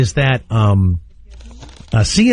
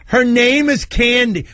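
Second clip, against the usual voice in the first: mocking high voice; 7 semitones higher than usual. Repeats the mockery.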